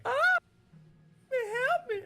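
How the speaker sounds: high, loud helpless cry; mock damsel crying for help; damsel in distress. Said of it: "I was gonna be like".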